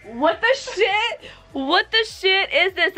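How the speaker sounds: shocked. Real, not acted. Shocked by the glue.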